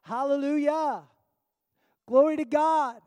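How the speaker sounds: loud, lifted praise cry; high, loud hallelujah; retold shout. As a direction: shout it out like the worshippers do. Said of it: "they shout".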